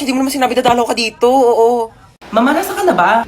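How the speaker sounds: usual speaking voice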